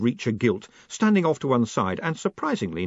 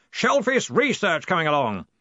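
shouting; 7 semitones higher than usual. A narrator's reading. Ridcully shouting.

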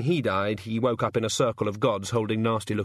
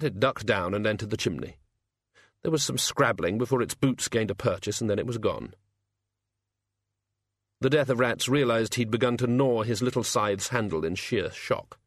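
usual speaking voice

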